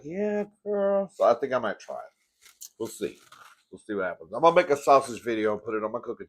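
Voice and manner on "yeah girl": high excited quote of a friend. Retold or imitated. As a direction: squeal it up, thrilled. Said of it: "She's like".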